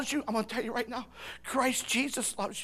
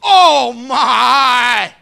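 loud, high, stretched-out mock amazement; awestruck teenager; 6 semitones higher, 16 dB louder, much slower than usual. Teenagers among the disciples, exaggerated awe. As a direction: play a wowed teen, hammed up. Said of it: "I can see the teenagers".

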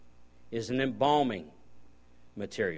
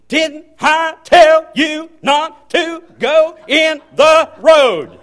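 much higher, much louder, slow drawn-out scolding; angry father auctioneer voice; 19 dB louder, much slower than usual. Retold or imitated. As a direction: yell it like a father mid-whipping, auctioneer-style. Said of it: "he sounded like this when he's beating me".

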